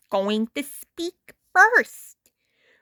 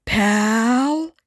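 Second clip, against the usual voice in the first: Ren voice, questioning. A narrator's reading. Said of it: "asked Ren".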